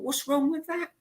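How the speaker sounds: high, soft mock disbelief; light mock indignation; incredulous. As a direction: shrug it off as obvious. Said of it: "And I thought".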